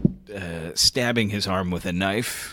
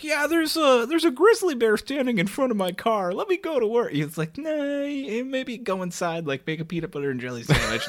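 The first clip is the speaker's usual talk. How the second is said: high-pitched caller voice; 12 semitones higher than usual. Acted. Caller phoning in to work.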